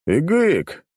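high, loud horse cry; horse whinny. Acted. Horse greeting its returning owner, whinnying.

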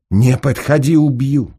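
high, loud warning; threatening shout. Acted. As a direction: warn them off at full voice. Armed woman warns off attacker. (громкая высокая угроза)

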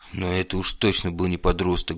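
ordinary delivery

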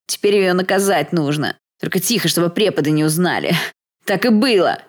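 frantic self-justifying tirade; desperate outburst. Acted. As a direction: spill it all out in agitated frustration. Pinned thug protesting in desperation.

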